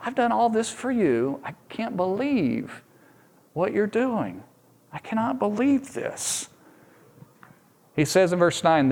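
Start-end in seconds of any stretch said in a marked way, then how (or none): 0.0-6.5 higher, hushed, wounded disbelief; hurt God. Acted. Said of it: "and he's like".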